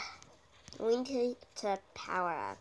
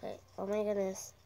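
ordinary talk, nothing marked

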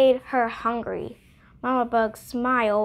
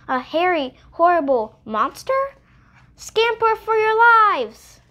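higher and louder, slowing into a frantic cry; little mouse raising the alarm, much higher; panicked mouse; 7 semitones higher, 5 dB louder than usual. Acted. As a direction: squeak out the alarm to everyone. Mouse panics at monster news.

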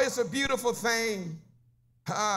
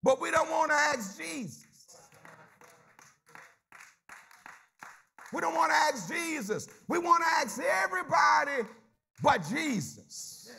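preaching; 4 semitones higher than usual. Acted. Preacher rousing his church, full-force preaching. Preacher building congregation call.

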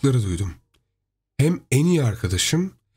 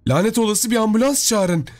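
urgent, high cry for help; acted yell, raised in pitch; 8 semitones higher than usual. A narrator's reading. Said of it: "diye bağırdı karım".

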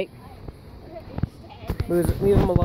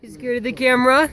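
louder teasing; 5 semitones higher, 4 dB louder than usual. Real, not acted. Repeated louder to the dog.

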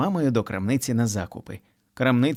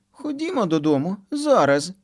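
put-on demanding child; 8 semitones higher than usual. A narrator's reading. Kitty demands mom leave now, high child voice. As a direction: insist now.

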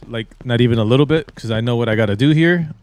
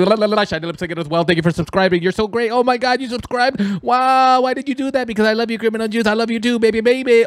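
much higher, fast, giddy; silly falsetto fan; 9 semitones higher than usual. Pretend. Gamer plays a giddy subscriber thanking viewers. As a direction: squeal it like a lovestruck fan. Acted subscriber love scene.

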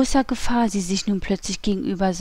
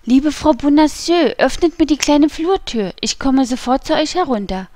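high, raised calling voice; 5 semitones higher, 4 dB louder than usual. A narrator's reading. Young guardsman upstairs asking to be let in, calling. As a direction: call down urgently through the ceiling. Voice calls through the ceiling.

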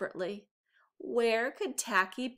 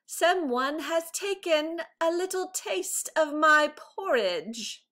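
louder, higher indignant complaint; Papa Bear crying out; 7 semitones higher, 5 dB louder than usual. A narrator's reading. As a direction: call out the empty bowl like a wronged father bear. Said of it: "Papa Bear held the large empty bowl and cried".

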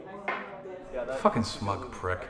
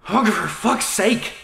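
higher, louder, slow fed-up cry; exasperated outburst; 9 semitones higher, 7 dB louder, slower than usual. Acted. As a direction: explode in frustration. Fed up with salad eater.

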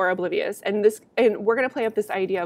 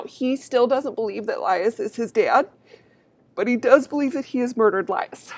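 yawning; 2 semitones higher, 4 dB louder than usual. Real, not acted. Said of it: "sorry for the yawn".

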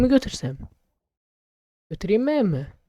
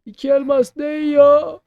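louder outraged complaint; indignant; 7 semitones higher, 9 dB louder, slower than usual. Real, not acted. Player indignant at the shop price, louder. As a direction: complain loudly about the price.

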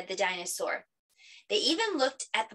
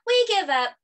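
high, loud surrender; children calling out; 11 semitones higher, 8 dB louder than usual. Acted. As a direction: call it out like kids in a game.

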